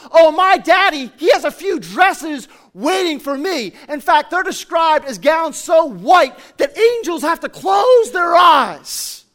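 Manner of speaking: high, very loud acted proclamation; full-force preacher's climax in a girl's words; triumphant shout. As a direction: shout it exultantly as the child, building to a stretched finish. Acted girl's climax of sermon story.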